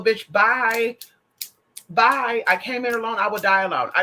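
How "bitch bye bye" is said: sassy dismissal. Real, not acted. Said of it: "dismissive sign-off".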